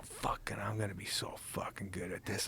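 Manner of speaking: whispered pep talk. Retold or imitated. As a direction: hush it like a secret promise to yourself. Alone at night, self-talk retold.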